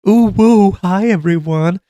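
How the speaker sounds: hammed-up perky healer hello; mock bubbly healer. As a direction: squeal it like an overexcited party healer. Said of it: "everyone is like".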